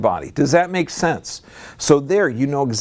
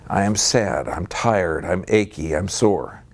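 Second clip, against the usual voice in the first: low, weary list of complaints; lower-pitched, downhearted demonstration; demonstrated sad slump; 7 semitones lower, slower than usual. Shown as a demonstration. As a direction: say it drooping and drained, a demo of self-defeat. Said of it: "volume, and intonation".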